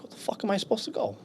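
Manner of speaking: high, fast retold protest; baffled protest. Released Marine with nowhere to go, baffled protest. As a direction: blurt it high and quick, exasperated.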